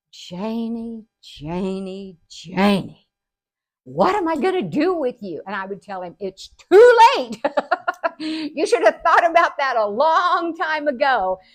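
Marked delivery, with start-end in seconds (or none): 0.1-3.1 drawn-out name, louder on the last; exasperated dad. Retold or imitated. Dad despairing of his daughter's antics, exasperated. Dad's exasperated reaction, retold. 3.8-5.4 higher, louder imitated scolding; exasperated dad. Dad to his wayward daughter, exasperated. 6.2-11.4 high, loud retort with a laugh; gleeful comeback. Retold or imitated. Daughter teasing exasperated dad, gleeful comeback.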